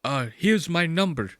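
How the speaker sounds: quiet come-on in a put-on accent; imitating Ari. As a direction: play a clumsy foreign suitor. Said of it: "And then he's like".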